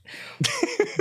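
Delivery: high laugh. Real, not acted. Game master amused by the players' banter, laughing. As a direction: burst into a high-pitched laugh.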